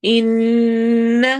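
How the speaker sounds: slow chanted tajweed with a long drawn ha; Quranic recitation. Shown as a demonstration. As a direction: recite it, stretching the vowel. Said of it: "نون پہ غنہ ہوگا".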